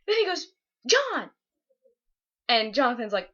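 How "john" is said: high-pitched, sharp call of a name; stern calling out. Retold or imitated. Principal rounds on second student in library, stern call. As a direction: call out the culprit like a cross principal.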